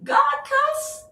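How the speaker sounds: very high, incredulous question; mock objector. Reader mimics listeners doubting God cusses, mock objector. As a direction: squeak the question in mock disbelief. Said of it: "Y'all like".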